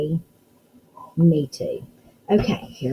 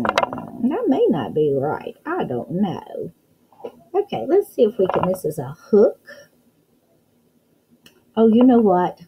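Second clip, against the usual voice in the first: normal talking voice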